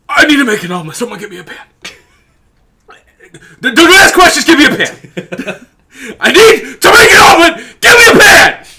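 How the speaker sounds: rising from plea to laughing shout; mock drunk demand. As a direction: build up until yelling for the pan. Voicing drunk guy demanding pan.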